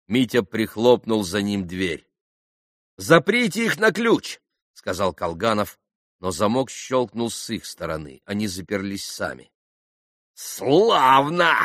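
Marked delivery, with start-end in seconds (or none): 3.0-4.4 high-pitched urgent order; called-out order. A narrator's reading. Young man wants the pans locked in, calling out. 10.3-11.5 high, drawn-out spiteful exclamation; malicious cry. A narrator's reading. Grushenka gloats over locked-in pans, crying out maliciously. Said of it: "злобно и беспощадно крикнула опять Грушенька".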